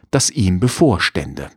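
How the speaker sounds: plain reading voice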